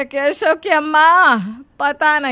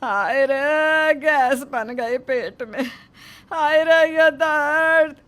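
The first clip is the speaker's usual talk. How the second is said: slow drawn-out groaning lament; moaning in pain; 2 semitones higher, slower than usual. Acted. Mother-in-law moaning to the neighbour about her stomach pain, slow groans. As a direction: moan slowly as if the belly aches.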